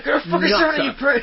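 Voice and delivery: mock angry instructor. Voicing maniac Irish driving instructor.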